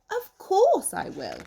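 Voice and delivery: high squeaky little squirrel. Acted. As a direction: play the cheeky tiny squirrel. Outraged squirrel agrees.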